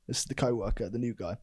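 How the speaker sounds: ordinary talk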